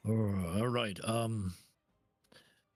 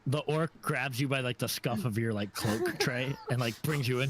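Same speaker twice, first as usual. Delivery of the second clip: ordinary delivery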